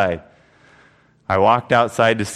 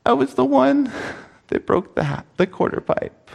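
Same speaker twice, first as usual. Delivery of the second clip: imitated sobbing boy; 11 semitones higher than usual. Pastor imitates the crying boy confessing, high and tearful. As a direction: play a crying little boy owning up. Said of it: "He's just bawling his eyes out".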